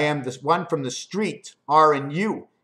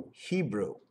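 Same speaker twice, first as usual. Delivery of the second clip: lower, much softer Hebrew; 6 semitones lower, 9 dB quieter than usual. Shown as a demonstration. Repeating Hebrew to himself, low and soft. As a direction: let Hebrew sink low and quiet. Word said twice in contrast.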